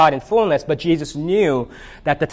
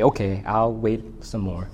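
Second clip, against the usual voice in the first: softer, slow, deflated giving in; sheepish; 5 semitones lower, 5 dB quieter, slower than usual. Acted. Elephant returning to Piggy, sheepish and softer. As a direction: mumble it like a kid caught sulking. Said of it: "return sheepishly and say".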